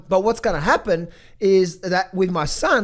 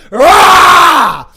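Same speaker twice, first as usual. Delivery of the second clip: single explosive high roar; lion roar; 11 semitones higher, 17 dB louder than usual. Real, not acted. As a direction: roar it out at the listener. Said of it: "this fucking lion come out".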